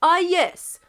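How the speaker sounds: mock agreement; Russian voice. Russia answering England, Russian accent. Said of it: "said Russia".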